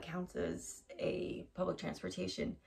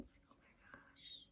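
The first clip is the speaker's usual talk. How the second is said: hushed, scandalized aside; mock-outraged whispering; gossipy whisper; 25 dB quieter, slower than usual. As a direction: whisper behind someone's back to a coworker. Acting gossip to a coworker behind back.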